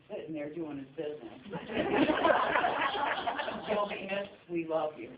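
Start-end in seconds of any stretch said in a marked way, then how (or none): 1.4-3.6 high loud laugh. Real, not acted. Laughing at bathroom story.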